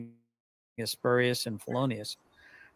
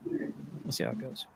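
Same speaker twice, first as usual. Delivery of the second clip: whispered; 10 dB quieter than usual. Shown as a demonstration. Repeats the line, then whispers it.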